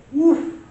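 a sudden high, loud "woo"; high whoop. Real, not acted. Host letting off steam about a heavy topic, high whoop. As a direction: blow off the tension with a whoop.

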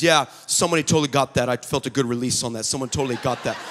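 very low, quick, offhand imitation; cool self-assurance. Daughter rating her own prayer to dad, mock cool. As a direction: toss it off deep and nonchalant.

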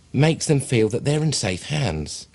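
normal talking voice

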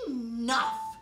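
loud, sharp cross conductor; snapped. Acted. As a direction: cut them off sharply as the boss. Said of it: "snapped the conductor".

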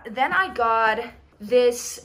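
normal talking voice

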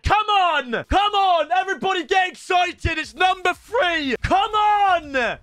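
rally everyone up; hype shout. Real, not acted. Hyping next pack.

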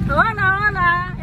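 high, sing-song hello; cheerful greeting. Real, not acted. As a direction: call out a happy hello. Greeting friends on the drive.

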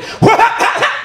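a high, hammed-up dumb laugh. Comic mocking the crowd with a high fake laugh. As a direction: cackle like a dumb audience.

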